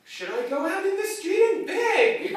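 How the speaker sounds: very high, loud, put-on pitiful old lady; mock self-pity with a laugh; melodramatic grandma. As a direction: ham up the beggar's plea. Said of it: "she starts asking me".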